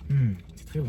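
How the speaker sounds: talking with a full mouth; words round a mouthful of fries; chewing while talking. Real, not acted. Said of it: "je parle la bouche pleine".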